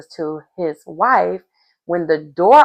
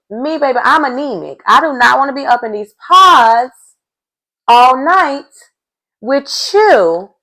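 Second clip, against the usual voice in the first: louder, higher sass; sassy, higher and louder; 6 semitones higher, 5 dB louder than usual. Real, not acted. Vlogger talking back at the suitor, higher and louder. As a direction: sass him, pitch up.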